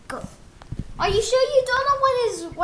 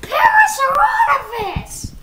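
shouted, slow and drawn out; sputtering foiled villain; 5 semitones higher, 7 dB louder, slower than usual. Acted. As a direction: shake your fist and roar the name. Villain cursing foe's name.

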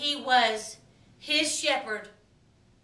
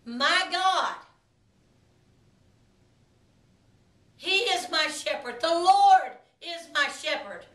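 declaring aloud. Shown as a demonstration. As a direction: speak it out boldly, lifting on "Lord". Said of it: "speak it out of our mouth".

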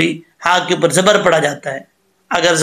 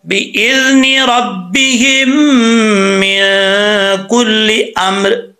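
slow, melodic tajweed chant, higher and drawn out; Quranic recitation; 3 semitones higher, much slower than usual. Shown as a demonstration. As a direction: recite the verse slowly with a rising melody. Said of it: "कैसे?". (धीमी, सुरीली तिलावत)

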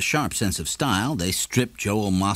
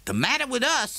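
much higher, cool comeback; Morrison's retort. A narrator's reading. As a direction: answer the shopkeeper's shout calmly.

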